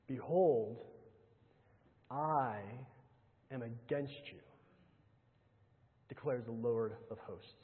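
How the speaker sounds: solemn hush. Acted. Solemn reading of God's threat.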